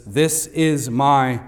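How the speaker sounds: slow, weighty quote. Shown as a demonstration. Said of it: "He affirmed the identity of Jesus".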